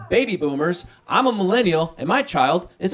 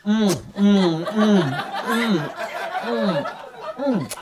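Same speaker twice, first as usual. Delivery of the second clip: rising mm mm of savoring licorice; mock sucking sounds; 2 semitones higher, much slower than usual. Comic picturing himself in public sucking licorice, savoring hums. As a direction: hum with exaggerated relish. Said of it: "me out in public going".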